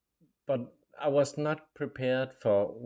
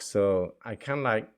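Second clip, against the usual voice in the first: usual speaking voice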